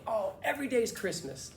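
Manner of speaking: high, upbeat imitation of Andy; Andy's happy catchphrase. Coach imitating his friend answering a greeting, happy catchphrase. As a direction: exclaim it like the happiest guy alive. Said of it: "He used to say that, like that".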